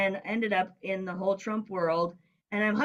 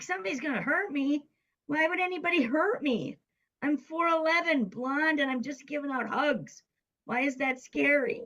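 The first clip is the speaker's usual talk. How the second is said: mock-innocent protest, pitched high; incredulous retort; 7 semitones higher than usual. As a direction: protest in a higher voice, disbelieving. Incredulous reply to friend's warning.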